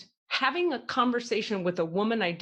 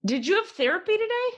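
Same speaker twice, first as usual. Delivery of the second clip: mock innocent question; 12 semitones higher, 3 dB louder, faster than usual. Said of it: "And I go".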